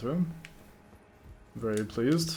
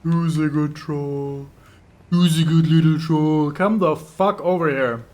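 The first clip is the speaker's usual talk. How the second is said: pet-talk coaxing; 3 semitones higher, 8 dB louder, slower than usual. Pretend. Player coaxes the troll like a pet, slow sing-song. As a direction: coax the troll like a good dog.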